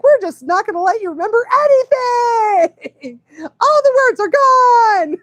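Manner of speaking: high, loud, laughing voice of her dyslexia; mock dyslexia voice. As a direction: play the brain gleefully wiping words. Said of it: "my dyslexia is like".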